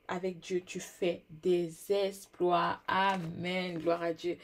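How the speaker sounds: slow drawn-out proclamation; preaching. Real, not acted. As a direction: stretch out the blessing. Repeats proclamation.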